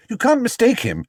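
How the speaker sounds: put-on a keen child adding detail; Judy voice. Judy telling the detective, high and eager.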